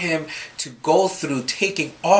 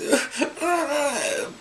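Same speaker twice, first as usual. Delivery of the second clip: imitated child's high, soft whimper; high wordless whine, mimicking a struggling boy; 11 semitones higher, 5 dB quieter than usual. Dad mimics son pulling off his shirt, whimpering. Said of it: "And then he whimpers".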